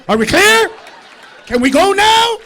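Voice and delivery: high, loud exasperated shout; angry dad. Acted. Dad to mom at the door, angry shouting. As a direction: bark the questions to end the argument. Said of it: "And my dad got mad".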